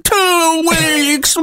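high, strained cry of "Two weeks"; Total Recall woman. Retold or imitated. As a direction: belt it out like the malfunctioning mask. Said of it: "Arnold Schwarzenegger in Total Recall ... she's like".

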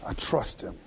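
near-voiceless, very soft; emotional, quiet trust. Acted. Preacher repeats what God would say, barely voiced. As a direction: barely get the words out. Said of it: "get spiritually emotional here".

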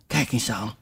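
usual speaking voice

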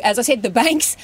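ordinary talk

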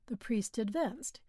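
ordinary delivery, nothing marked

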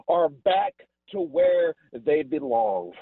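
usual speaking voice

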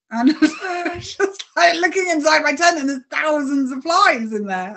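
high, laughing disbelief; laughing while telling. Real, not acted. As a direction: laugh through the memory. Laughing recounting flies in tent.

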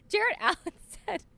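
talking through laughter; words bubbling with laughter; laughing while talking. Real, not acted. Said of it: "I could hear you smiling".

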